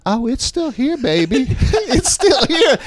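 high, showboating comeback with a laugh; playful star imitation. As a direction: crow it high, stretching the end. Imitates star playing along, belly shown.

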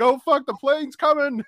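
high, squeaky Australian accent played for laughs; mock Australian accent. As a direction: panic in a cartoonish Australian accent. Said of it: "laughing at the accent because it's so adorable".

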